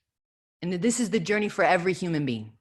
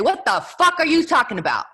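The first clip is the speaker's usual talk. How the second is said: louder, higher impatient snapping; mock harsh mother; 8 semitones higher, 8 dB louder than usual. Mother acting out yelling at her crying daughter, louder. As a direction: snap at the child like you're fed up. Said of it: "if I look at her and say".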